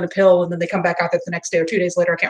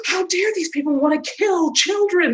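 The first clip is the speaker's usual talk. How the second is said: mock astonishment; 8 semitones higher than usual. Streamer mimicking people astonished at threats to kids, mock shock. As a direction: act appalled with a big theatrical gasp and slow, high words. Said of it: "people who are just astonished".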